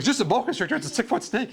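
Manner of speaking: high, disbelieving retort; incredulous. Retold or imitated. Traveller protesting to the calm camper, high incredulous retort. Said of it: "I'm like".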